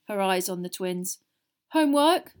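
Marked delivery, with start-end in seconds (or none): 1.7-2.3 vague but raised voice. A narrator's reading. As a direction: give an evasive answer, higher and louder. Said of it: "said Fred vaguely".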